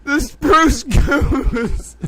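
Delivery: high, slow, tearful laughing voice; laughing to tears. Real, not acted. Host repeats the silly name, crying with laughter. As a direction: squeak it out through helpless laughter. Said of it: "I'm crying. Te-, towel tears of laughter".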